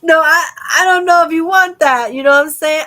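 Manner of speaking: loud, high laughing speech; real laughter spilling into the words. Real, not acted. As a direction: talk through a big burst of laughter. Bursts out laughing mid-conversation.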